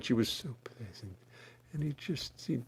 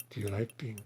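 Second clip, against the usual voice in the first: ordinary talk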